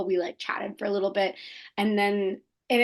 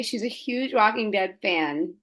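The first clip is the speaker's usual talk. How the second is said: normal talking voice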